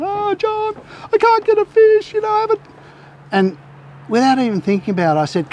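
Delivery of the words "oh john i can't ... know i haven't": high-pitched mock panic, imitating a flustered mate; mad panic. Carl panicking to John about catching nothing, high mock panic. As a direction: squeak it out like a panicking fisherman. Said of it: "he's in a mad panic".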